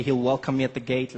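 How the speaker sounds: ordinary delivery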